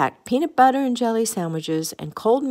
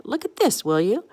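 mild, high-pitched exclamation; crying out; 4 semitones higher than usual. A narrator's reading. Goofy shows everyone his knotted pants, crying out mildly. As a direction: start high, end on a rising question. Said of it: "Goofy cried".

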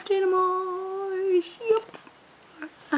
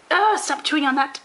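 ordinary talk

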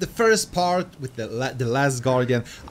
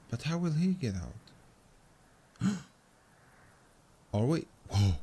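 normal talking voice

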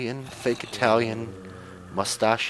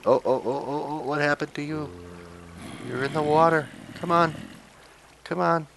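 higher, urgent coaxing; a pitched-up fret over the zombie; excited coaxing; 6 semitones higher than usual. Real, not acted. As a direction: urge the zombie out of the water. Zombie stuck in water.

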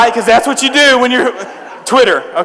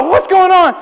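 higher-pitched mock panic; retold alarm; 9 semitones higher than usual. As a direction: play up your confused alarm.

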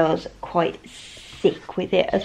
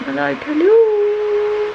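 high, slow drawn-out hello; cutesy hello; 12 semitones higher, 5 dB louder, much slower than usual. Real, not acted. Greeting toy in cute voice.

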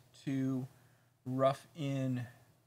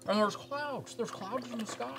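put-on high old-movie transatlantic voice; Jimmy Stewart impression; 10 semitones higher than usual. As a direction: play an old Hollywood leading man. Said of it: "Jimmy Stewart".

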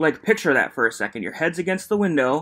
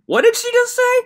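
very high, louder incredulous question; shocked, voice jumping way up; stunned disbelief; 6 dB louder than usual. Real, not acted. After hearing the windshield line, high shocked disbelief.

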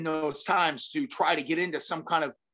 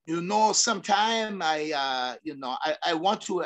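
George Gurgel. Retold or imitated. Friend confiding in the speaker at his gym, imitated accent. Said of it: "do my best George, uh, accent".

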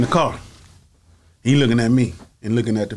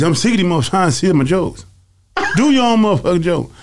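higher, louder mock rant; lightly hammed-up comic grumbling; imitating Bernie; 7 semitones higher, 4 dB louder than usual. Bernie ranting about joke thieves, mock rant.

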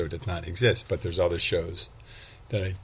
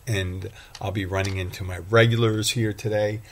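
ordinary talk, nothing marked

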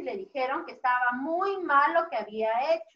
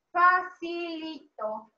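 very slow, syllable-by-syllable delivery; encouraging drawl; much slower than usual. Real, not acted. Teacher encourages children answering the question, slowly drawn out. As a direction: stretch every syllable out playfully. Teasing children that it's easy.

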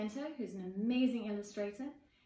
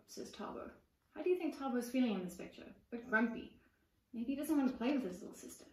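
ordinary delivery, nothing marked

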